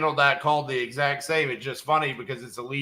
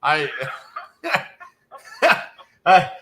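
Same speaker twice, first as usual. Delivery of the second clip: ordinary talk, nothing marked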